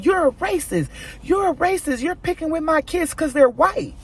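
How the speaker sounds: high pitched mock yell of an accusing dad; hammed-up angry neighbor shouting. Son retelling the dad yelling at him, mock shouting.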